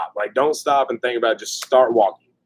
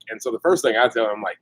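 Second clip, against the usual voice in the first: ordinary delivery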